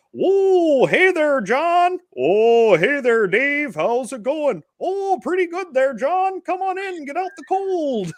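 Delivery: put-on very high, loud, slow Canadian accent; Canadian brothers greeting. Grandfather greets his brother John, loud high Canadian accent. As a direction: ham up two Canadian brothers greeting at the door. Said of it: "He talked funny".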